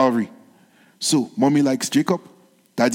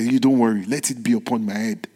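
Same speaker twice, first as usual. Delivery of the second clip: reassuring mother; 6 semitones lower, 3 dB quieter, faster than usual. Retold or imitated.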